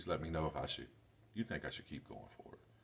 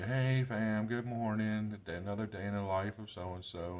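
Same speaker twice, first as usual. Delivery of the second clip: louder, higher cheery YouTuber hello; mock vlogger greeting; 4 semitones higher, 6 dB louder than usual. Mocking the daily-life vloggers with a perky greeting. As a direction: ham up the perky morning vlog intro. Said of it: "I'm not worried about seeing you".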